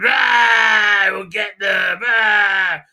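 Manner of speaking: loud drawn-out attack yell; hammed-up war cry; mock battle cry. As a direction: yell it like a hammed-up charging villain. Acting out villain's charge.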